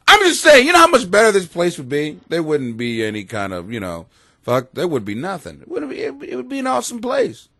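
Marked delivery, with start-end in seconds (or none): none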